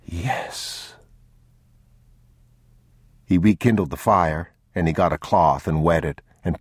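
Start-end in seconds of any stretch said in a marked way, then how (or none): 0.0-1.0 breathless soft whisper. Acted. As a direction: whisper with relief. Follows whispered yes.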